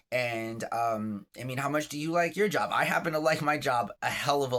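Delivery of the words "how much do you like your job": ordinary talk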